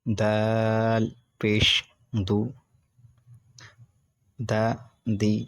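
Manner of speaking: measured teacher recitation with long pauses; patient classroom drill; slow spelling drill. Shown as a demonstration. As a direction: spell each syllable out for students to repeat.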